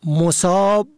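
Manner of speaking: مصاب said "mosab"; contrasted first vowel. Shown as a demonstration. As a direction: say مصاب with a short o after m. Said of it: "حال فرق بین مصاب". (مصاب با ضمهٔ میم: مُصاب)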